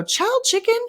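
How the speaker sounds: high squeaky quote; student's catchphrase. Retold or imitated. Student teasing her teacher, high mock voice. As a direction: pitch way up like the teen student. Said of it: "she'd go".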